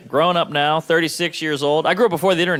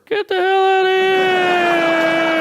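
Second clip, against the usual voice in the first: very high, slow, stretched-out holler; mock outraged shout; 4 dB louder, much slower than usual. Listener to parent bragging of sober four-year-old, outraged holler. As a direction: drag the last word into a long high yell.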